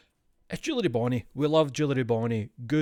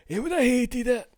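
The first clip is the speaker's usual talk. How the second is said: mock outrage; 12 semitones higher, 6 dB louder than usual.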